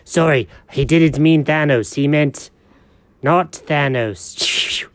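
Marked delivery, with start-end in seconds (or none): none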